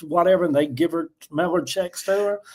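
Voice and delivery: usual speaking voice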